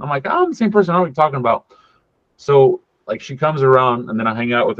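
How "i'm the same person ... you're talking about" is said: imitating a high-pitched protest; retold protest. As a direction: act out a puzzled denial, voice jumping up high. Protesting to someone doubting him.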